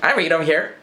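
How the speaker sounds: high, eager I-read voice; high excited reader voice; eager high hand-raise. Retold or imitated. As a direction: call out keenly from the side. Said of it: "So I'm like".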